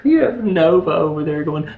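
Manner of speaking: normal talking voice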